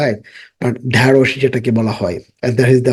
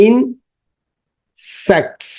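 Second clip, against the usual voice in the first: Insects very slow, higher, split into parts; slow broken-up word; 8 semitones higher, much slower than usual. Shown as a demonstration. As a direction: break insects into pieces slowly. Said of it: "ভেঙে আমাদের শব্দটা উচ্চারণ".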